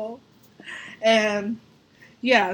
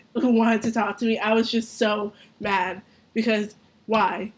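normal talking voice

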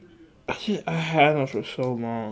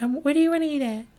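put-on high, quick, girly voice; girlfriend impression; faster than usual. Mocking his girlfriend's indecision in a high girly voice. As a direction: mimic an indecisive girlfriend, pitched way up. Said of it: "She'd be like".

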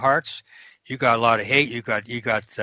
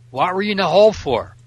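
higher, louder pointed question; 7 semitones higher, 4 dB louder than usual. Retold or imitated. Chaplain retells grilling an inmate about the hole, higher and louder. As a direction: drill him for the answer.

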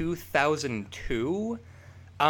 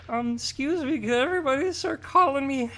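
high-pitched put-on voice, mocking; mock self-important request; 10 semitones higher than usual. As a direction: mimic someone announcing a silly new name. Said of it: "and you're like".

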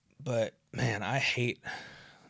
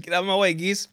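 raised voice ordering geese off; mock yell at geese; 8 semitones higher, 5 dB louder, faster than usual. Jogger yelling at geese blocking the path, mock yell. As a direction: shoo the geese away, higher and louder. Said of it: "And I'm like".

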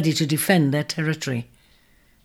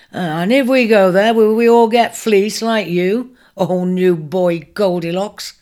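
louder, mocking taunt; mocking jibe; 3 semitones higher, 5 dB louder than usual. A narrator's reading. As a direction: jeer at him for the crowd. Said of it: "jibed another with mocking laughter".

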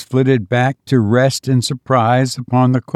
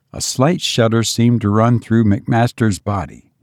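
plain reading voice

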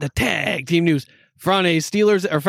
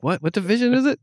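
confused; 6 semitones higher than usual. Retold or imitated. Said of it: "I'm like".

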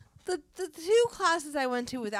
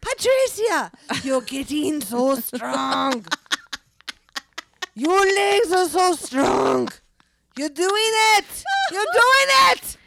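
put-on slow, high, loud French-accented cheer; gushing French coach praising her students; French teacher's accent; 8 semitones higher, 8 dB louder, slower than usual. Teacher cheering her swim students in a French accent. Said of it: "She'll be like".

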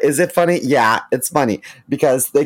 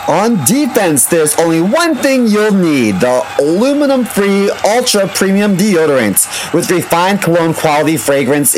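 ad read; 6 semitones higher, 5 dB louder than usual. Acted. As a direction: announce the bundle like a big reveal. Ad read as football lineup.

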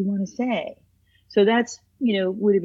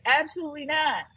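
higher mock husband's denial; indignant husband; 7 semitones higher, 3 dB quieter than usual. Husband answering the therapist, mock denial, pitched up. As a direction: play the husband firmly denying it. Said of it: "And he'll say".

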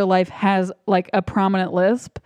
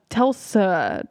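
normal talking voice

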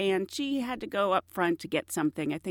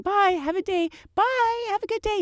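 singsong flight attendant goodbye; 13 semitones higher than usual. Retold or imitated. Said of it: "I'm just still saying".

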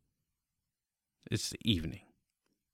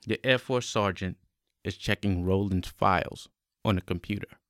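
normal talking voice

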